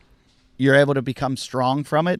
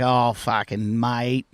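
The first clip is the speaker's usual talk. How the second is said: slow, softer, dismissive Aussie drawl; drawn-out mock-Aussie brush-off; Australian accent; 3 semitones lower, 5 dB quieter, much slower than usual. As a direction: imitate an unimpressed Australian surfer. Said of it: "That's very Aussie".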